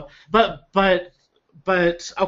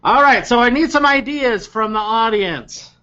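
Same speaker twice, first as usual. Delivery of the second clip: a hint of a bright game-show host Beth; pitched-up, upbeat call to the crowd; 8 semitones higher, 4 dB louder than usual. Beth asking the audience for ideas, lightly voiced and higher. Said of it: "And then Beth goes".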